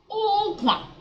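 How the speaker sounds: "Okwa" held high on the first vowel; accented U demonstration. Shown as a demonstration. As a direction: pitch the opening of "Okwa" high and long. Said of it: "The U with an accent will be okwa".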